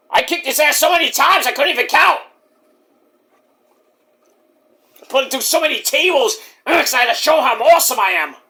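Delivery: high, boastful put-on voice; [name]. Wrestler boasting to host about beating his rival, high impression. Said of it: "You did, [name]".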